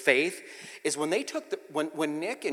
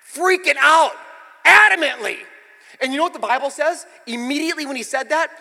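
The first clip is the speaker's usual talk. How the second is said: loud, high-pitched sermon climax; full-force preaching; 14 semitones higher, 16 dB louder than usual. Real, not acted. Preacher telling of Peter's third denial, full-force preaching. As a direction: drive it out forcefully to the congregation.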